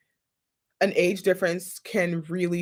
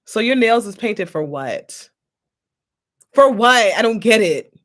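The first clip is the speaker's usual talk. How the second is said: louder, indignant grilling, punching "For what?"; sassy loud challenge; 3 semitones higher, 8 dB louder than usual. Pretend. To a Gen Z dude with painted nails, loud indignant grilling. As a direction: call him out, getting louder on "For what?". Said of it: "I'll just be looking at you like".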